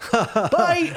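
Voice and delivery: high, loud imitated goodbye through laughter; loud mock farewell. Comedian abruptly leaving musician, loud high imitated farewell.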